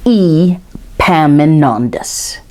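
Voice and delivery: Epaminondas said very slowly, each syllable spaced out; slow syllable breakdown. Shown as a demonstration. Teacher models Epaminondas slowly for children to repeat. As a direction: sound out Epaminondas for children to copy. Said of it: "let's break that down".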